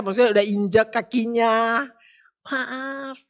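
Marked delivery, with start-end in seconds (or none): 2.4-3.2 much softer, meek; gentle apology; soft polite sorry. Retold or imitated. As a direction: murmur a timid sorry. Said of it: "dia sama penyampaian".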